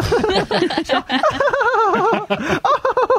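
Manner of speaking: real burst of high giggling laughter; high laughing fit. Real, not acted. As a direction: crack up into high helpless giggles.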